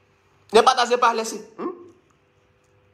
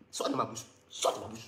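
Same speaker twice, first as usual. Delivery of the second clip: mock hissed whisper; 10 semitones lower, 14 dB quieter than usual. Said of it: "Sors de ma bouche, c'est quoi ça là".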